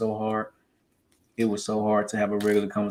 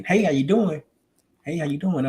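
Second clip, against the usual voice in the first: less bubbly phone greeting; toned-down older voice; 5 semitones higher than usual. Retold or imitated. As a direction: greet more evenly, as if sounding older. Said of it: "Now I'm talking all low and monotone".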